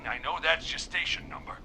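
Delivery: normal talking voice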